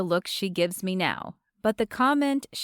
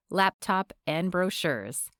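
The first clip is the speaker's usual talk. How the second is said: plain reading voice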